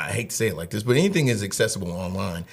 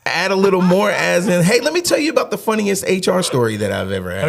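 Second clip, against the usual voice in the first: much higher and louder, like a showman; mock hype; 10 semitones higher, 8 dB louder than usual. Teasing the host by hyping a story, excited. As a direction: pitch it like a grand announcement.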